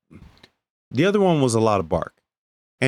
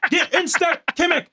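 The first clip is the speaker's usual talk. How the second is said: high drill instructor yell. Retold or imitated. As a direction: bark orders like a drill sergeant from hell. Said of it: "he would talk like this".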